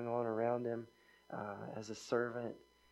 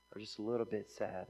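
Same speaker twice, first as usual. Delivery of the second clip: normal talking voice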